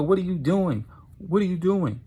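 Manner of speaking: high-pitched, startled demand; alarmed protest. Acted. Mandy dodges Tucker's boulder, high alarmed protest.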